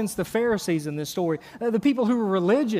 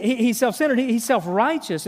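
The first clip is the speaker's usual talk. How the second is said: ordinary talk, nothing marked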